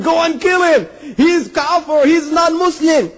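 very high, outraged command; angry ruler. Retold or imitated. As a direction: act out an enraged ruler condemning someone. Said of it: "Now he got angry".

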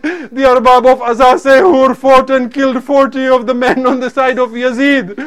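high-intensity, anguished martyrdom narration; fervent majlis lament; impassioned preaching. Acted. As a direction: preach it at full force, grieving.

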